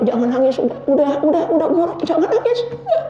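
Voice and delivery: a friend soothing him to stop crying, rising in pitch; friend consoling. Retold or imitated. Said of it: "Eh, dia bilang".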